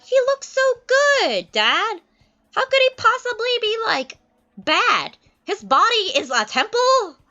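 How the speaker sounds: put-on very high, loud, swooning girl; Miranda's voice. Miranda pleads for Ferdinand, swooning and very high. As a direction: gush over him to dad. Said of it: "Miranda's like".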